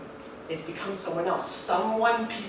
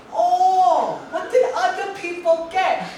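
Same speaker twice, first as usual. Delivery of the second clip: high, loud dad impression; father brushing off his son's A minus; imitated dad; 11 semitones higher, 5 dB louder, slower than usual. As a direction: fire back the question as his father.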